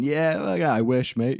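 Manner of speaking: high, loud cheeky cheer; mock cheer. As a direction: crow it out like a mate high-fiving. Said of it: "imagined high-five reply".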